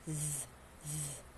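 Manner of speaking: "Z" as a soft, low buzzing voiced TH; tongue between teeth. Shown as a demonstration. Teacher isolates the sound for students, soft voiced TH. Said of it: "Paglabas ng konti ng dila".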